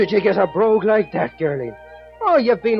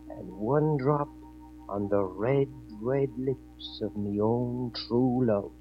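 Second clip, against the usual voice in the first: soft, slow, low and solemn; hushed ritual; 5 semitones lower, 6 dB quieter, slower than usual. Acted. Jamie gives the magic drops to his love, soft and slow. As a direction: count out each drop like a spell.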